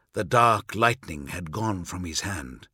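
usual speaking voice

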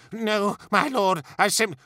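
high-pitched, cowed subordinate; scared, placating stammer; frightened protest. A narrator's reading. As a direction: plead your case and get cut off. Terrified officer answers raging lord.

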